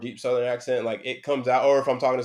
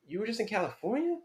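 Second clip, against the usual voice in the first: high-pitched mock disbelief, quieter; incredulous; 10 semitones higher, 5 dB quieter than usual. High school friends hearing about his race trips, incredulous. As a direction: imitate amazed friends with a high rising question.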